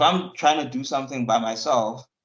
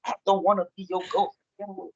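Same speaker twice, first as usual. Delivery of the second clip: mock panicked plea; 6 semitones higher, faster than usual. Acted quote, high and fast.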